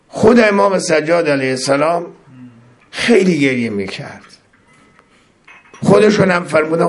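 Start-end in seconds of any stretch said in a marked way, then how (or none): none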